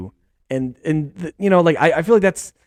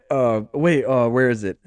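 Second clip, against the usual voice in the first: normal talking voice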